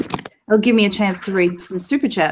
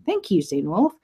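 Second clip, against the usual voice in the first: ordinary delivery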